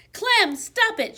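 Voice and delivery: high, loud and slow firm command; firm shout. A narrator's reading. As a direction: stop the dog, loud and slow. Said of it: "she shouted in a very firm voice".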